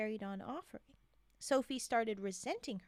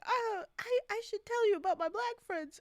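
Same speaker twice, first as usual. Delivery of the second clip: high, louder mock voice; mocking a clueless friend; 9 semitones higher, 6 dB louder than usual. She's imitating Sophie's awkward friend, high and loud. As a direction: parody her, pitched up and loud. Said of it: "And she's like".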